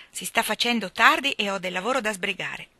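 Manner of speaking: ordinary delivery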